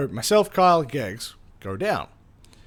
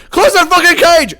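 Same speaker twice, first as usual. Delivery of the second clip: much higher, louder yelled command; shouted order; 14 dB louder than usual. Retold or imitated. Rescuer yelling at the boys to shut the cage, loud and high. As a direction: bark the order at full volume. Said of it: "and goes".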